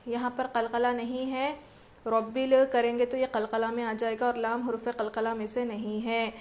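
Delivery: ordinary talk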